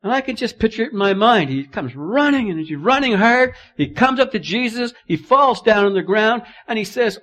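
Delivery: loud, high, quick preaching; breathless pulpit storytelling. Acted. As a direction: paint the scene for the congregation. Preacher retelling at full force.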